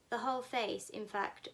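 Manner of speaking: ordinary delivery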